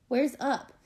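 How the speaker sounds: ordinary delivery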